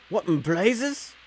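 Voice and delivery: high, drawn-out question in a put-on voice; lightly put-on accent, slow and high; switched-up character accent. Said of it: "He's kinda switching around his accents".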